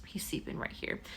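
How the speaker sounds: hushed unvoiced whisper; whispering about the sleeping cat. Real, not acted. Cat asleep beside her.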